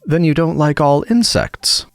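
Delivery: tiny insect calmly carrying on; gnat, unruffled. A narrator's reading. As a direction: ask it slowly in a little high voice. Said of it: "as quietly as if nothing had happened".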